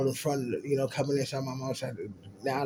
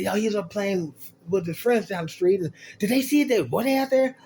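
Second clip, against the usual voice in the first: imitating mom; 7 semitones higher, 4 dB louder than usual. Mom questioning about witnesses.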